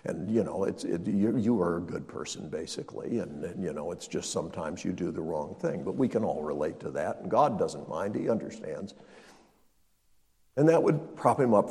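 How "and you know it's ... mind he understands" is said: a soft, lowered, hushed reassuring voice of a placating pastor; soothing clergy imitation. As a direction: gently brush off his guilt, barely above a murmur. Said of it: "and they tell you".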